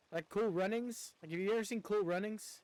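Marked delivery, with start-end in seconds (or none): none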